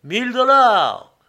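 high, loud, drawn-out auction cry; ringing shout. A narrator's reading. The bid rings out over the auction room, ringing shout. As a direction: let the bid ring through the hall. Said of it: "ces mots retentissaient encore une fois".

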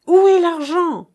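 high, loud shout; mother screaming for the money; cried out again. A narrator's reading. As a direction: scream the demand. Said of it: "s'écria-t-elle de nouveau".

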